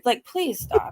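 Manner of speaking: usual speaking voice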